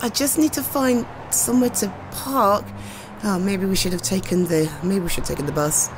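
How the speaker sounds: usual speaking voice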